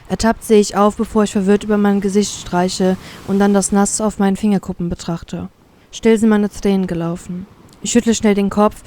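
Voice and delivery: ordinary delivery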